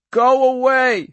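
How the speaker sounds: high, loud, drawn-out scream. A narrator's reading. Girl screams at the men to leave, screaming. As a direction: scream it slowly, stretching the words. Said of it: "she screamed".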